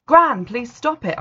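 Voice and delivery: pleading shout at quarreling women. A narrator's reading. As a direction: shout it out, dragging each word. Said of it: "I shouted".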